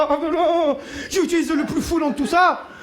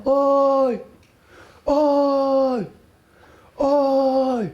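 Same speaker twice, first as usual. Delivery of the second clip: distant calls; much slower than usual. Retold or imitated. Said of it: "j'entends mes frères au loin".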